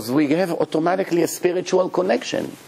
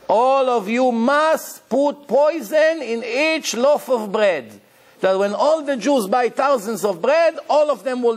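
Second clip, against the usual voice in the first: priest's decree; 8 semitones higher than usual. Retold or imitated. Rabbi retelling the priest's order to the bakers, raised and commanding. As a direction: announce the poison order like a cruel priest.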